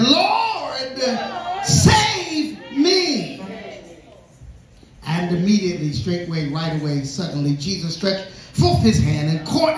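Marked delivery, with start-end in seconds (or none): 0.0-3.4 high, drawn-out drowning man's scream; fearful yell. Acted. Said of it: "He was yelling for fear of drowning".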